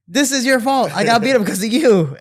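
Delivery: high, loud mock accusation; hammed-up exasperated yelling; angry blaming. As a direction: shout the blame at your friend. Blames friend while strangling him.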